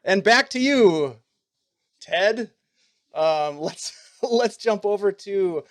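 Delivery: host wrapping the fake interview, hammed-up news anchor; mock news anchor. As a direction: toss it back to the studio like a newsman. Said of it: "I feel like we're doing, like, an interview".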